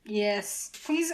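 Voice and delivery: usual speaking voice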